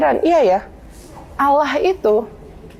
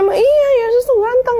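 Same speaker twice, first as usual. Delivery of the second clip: high-pitched insistence, retold; insistent retort; 13 semitones higher, 3 dB louder than usual. Answering his opponent about the picture of Jesus, insistent. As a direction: argue back in a much higher voice.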